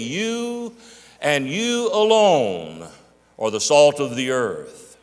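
slow, emphatic quote stressing You. Shown as a demonstration. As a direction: lean hard on you, and you alone. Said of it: "what we call the ph-, uh, emphatic".

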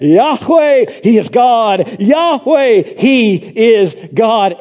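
loud, high proclaiming cry of Yahweh; crowd cry. Retold or imitated. People on their faces crying out, loud proclamation. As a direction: call it out in awe.

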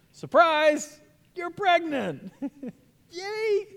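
spring it on her like a party surprise; a hammed-up, high-pitched "Surprise! You're pregnant. Yay!"; mock surprise announcement.

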